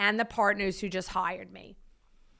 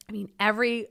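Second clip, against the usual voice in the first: ordinary talk, nothing marked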